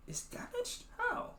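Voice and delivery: sudden high, breathy gasp of dismay; shocked disbelief. Real, not acted. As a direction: squeak it out in horror at the damage. Finds a crack in his device.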